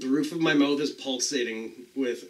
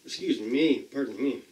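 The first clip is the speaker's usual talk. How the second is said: ordinary talk, nothing marked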